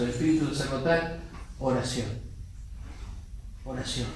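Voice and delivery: normal talking voice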